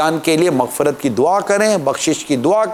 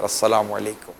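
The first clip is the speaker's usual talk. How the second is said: friendly greeting; 8 semitones lower, 7 dB quieter than usual. Shown as a demonstration. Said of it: "سلامی بھیج دو یار".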